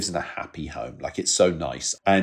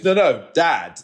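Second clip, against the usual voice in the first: put-on high insistent kid voice; child insisting; 7 semitones higher, 5 dB louder, slower than usual. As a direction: correct them like an impatient little boy. Child correcting, calling dad.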